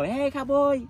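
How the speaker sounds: high, loud mock call across the street; hollering at a stranger. People on the street calling out to him.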